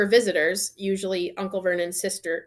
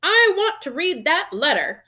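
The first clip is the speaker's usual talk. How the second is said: high, loud bratty insistence; loud demand; 9 semitones higher, 5 dB louder than usual. A narrator's reading. Spoiled son demands the letter, loudly. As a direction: demand it at raised volume. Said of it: "he said loudly".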